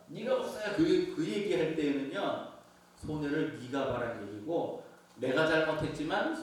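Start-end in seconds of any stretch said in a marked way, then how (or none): 0.1-0.7 taunting. Retold or imitated. Accuser's taunt retold.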